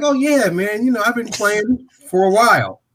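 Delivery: lightly voiced put-on nonchalance; casual bluff. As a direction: act like a golf veteran. Bluffing he has played long.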